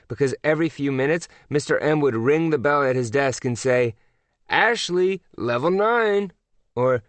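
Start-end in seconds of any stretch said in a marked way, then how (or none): 4.5-6.3 high, lilting call-out; announcing teacher. A narrator's reading. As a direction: announce it to the whole class like a prize. Teacher calls out level announcements.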